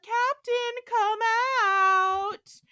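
high, loud mimicry of a woman calling; mimicking Chapel. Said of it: "Rock is also mimicking Chapel".

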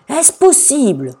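high, loud, drawn-out disbelief; shocked exclamation. A narrator's reading. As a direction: cry out like an astounded king. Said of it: "le roi s'écria". (exclamation stupéfaite)

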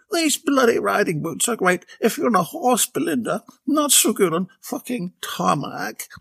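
fed-up Bella trudging on tarmac. A narrator's reading. Bella voiced in story.

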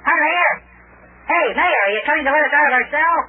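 high, eager hail down the hall; excited shouted greeting; calling out. Acted.

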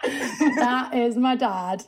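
said through a laugh. Real, not acted. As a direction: laugh while you say it. Echoing with a laugh.

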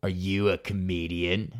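put-on low, slow, suspicious old woman; lightly voiced creepy desk lady; old clerk. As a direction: ask it like a gloomy old clerk. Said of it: "she goes".

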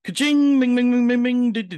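high singsong ka-ching bing bing; cash register jingle. Pretend. Cash register jingle sound effect.

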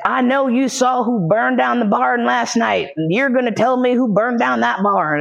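high-pitched, hammed-up interrogation; mock interrogator. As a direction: imitate a bully demanding answers. Acted interrogator pushing her down.

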